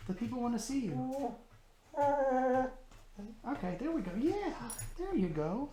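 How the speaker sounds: soft, high coaxing voice; puppy talk. Real, not acted. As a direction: gently encourage the dog, high and quiet.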